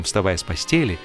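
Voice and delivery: usual speaking voice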